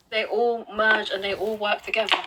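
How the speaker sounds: normal talking voice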